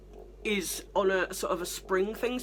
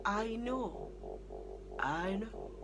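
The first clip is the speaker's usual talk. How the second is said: soft, sinking concession; grudging aside; 7 semitones lower, 7 dB quieter than usual. Real, not acted. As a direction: concede quietly, with pauses. Grudging aside excusing the helper.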